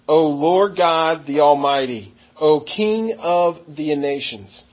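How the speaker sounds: slow, weighty reading of each title; slow emphatic title. Shown as a demonstration. Preacher showing the congregation the titles, slow and weighty. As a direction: lean on the titles, slowly. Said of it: "we see a title".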